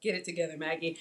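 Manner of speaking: low, soft aside to oneself; self-scolding aside. Real, not acted. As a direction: pull yourself together, under your voice. Scolds herself after laughing.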